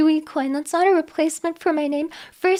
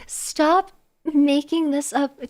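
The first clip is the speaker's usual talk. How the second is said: ordinary talk, nothing marked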